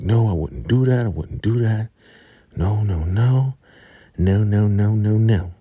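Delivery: high, rising panicked pleading; panicked denial. Retold or imitated. River panicking as he denies taking Yancey out. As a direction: deny it frantically, voice climbing.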